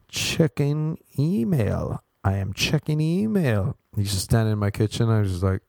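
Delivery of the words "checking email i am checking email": very slow, higher "Checking email"; mock robotic slowness. Visitor stalling in the host's kitchen: slow monotone. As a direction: spell it out like a dim robot.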